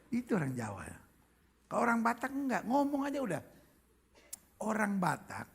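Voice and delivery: ordinary talk, nothing marked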